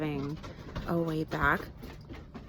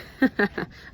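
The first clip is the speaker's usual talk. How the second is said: short unvoiced laugh at herself; breathy laugh; 4 semitones higher, 4 dB louder than usual. Real, not acted. Mom laughs off the wet seat, breathy chuckle. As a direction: laugh it off under your breath.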